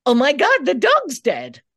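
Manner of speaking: loud, high, panicked cry of bad news; alarmed outburst. Shown as a demonstration. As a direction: burst out in shock, high and loud. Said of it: "if I were to say".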